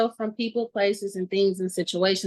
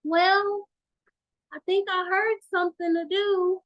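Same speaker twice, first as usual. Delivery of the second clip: mock a lazy believer, pitched up high; 10 semitones higher than usual. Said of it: "Or you just gonna sit here and be like".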